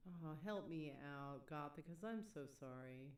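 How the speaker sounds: ordinary delivery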